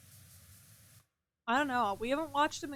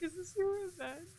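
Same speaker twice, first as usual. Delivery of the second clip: higher, softer imitation of a hurt question; mock tearful; 7 semitones higher, 7 dB quieter than usual. Repeating partner's revenge question, mock tearful. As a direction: lightly imitate a teary, accusing partner. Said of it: "tearing up and crying".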